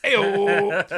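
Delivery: loud, high, drawn-out call; real whoop. Real, not acted. Host cheering the co-host's overkill pun, loud whoop. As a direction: holler it in mock celebration of a pun.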